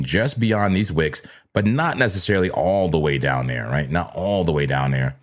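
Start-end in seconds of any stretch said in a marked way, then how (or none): none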